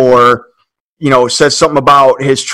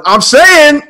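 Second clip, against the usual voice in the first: high-pitched mock holler; retold exclamation; 13 semitones higher, 4 dB louder than usual. Diner answering a passer-by about Duncan over Kobe, emphatic mock holler. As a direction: throw it out high, imitating an emphatic agreement.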